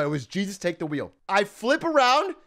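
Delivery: ordinary talk, nothing marked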